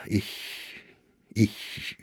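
words catching in the throat; faltering whisper. Acted. Old poet overwhelmed by the visitor's praise, stammering in a whisper.

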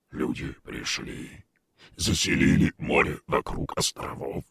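low, strange creature voice; gurgling alien voice. Acted. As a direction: speak like a beast from the deep. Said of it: "сказала оно".

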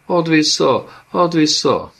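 high, loud shriek; the dead child shrieks for its cloak back; screaming. A narrator's reading. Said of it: "Zion felsikoltott".

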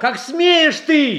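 high, slow, outraged demand; outraged cry. Acted. Tsar rebuking a slandering boyar, outraged and slow. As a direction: rise up in shock and fury.

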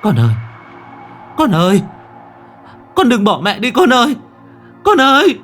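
loud, high desperate wail; mother's full-force grief-stricken cry; anguished scream. A narrator's reading. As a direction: scream for your child as she vanishes. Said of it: "Hiền gào lên".

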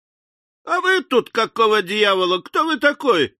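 fierce, high-pitched challenge; ferocious. A narrator's reading. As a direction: round on the stranger fiercely. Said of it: "таким свирепым тоном".

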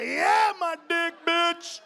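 high, full-blast boastful shout; triumphant yell. Retold or imitated. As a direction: roar it out in mock triumph. Triumphant acted reply in story.

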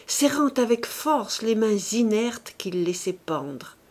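usual speaking voice

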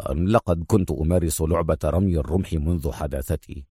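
low, soft, composed reply; calm. A narrator's reading. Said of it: "يقول في هدوء".

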